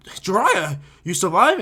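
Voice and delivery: loud acted astonishment; shocked surprise. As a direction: exclaim in shock, volume jumping up. Acted shock at his return.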